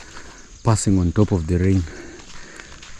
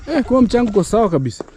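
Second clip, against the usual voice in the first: excited approval; 14 semitones higher, 4 dB louder, faster than usual. Real, not acted. Exclaiming approval to small child.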